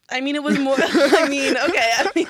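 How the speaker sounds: high, loud laughing through words; real laughter. Real, not acted. Co-host flustered by a date joke, laughing. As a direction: try to explain while cracking up.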